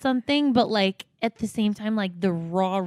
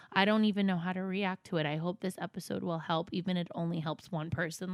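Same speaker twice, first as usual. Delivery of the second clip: softer, subdued reading voice; reading aloud; 4 dB quieter than usual. Acted. Friend reads mother and daughter's texts, soft reading. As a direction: read the messages out gently and evenly.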